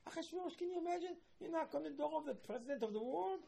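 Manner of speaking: outraged king. Retold or imitated. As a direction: play the king, appalled at the late-night visit. Mocking king's outraged reaction.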